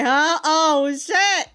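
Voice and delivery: ordinary talk, nothing marked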